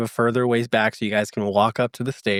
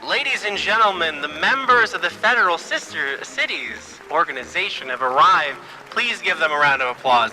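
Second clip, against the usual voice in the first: podium announcer; 5 semitones higher than usual. Acted. Betty May announces the arrivals into the microphone. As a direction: announce to the crowd.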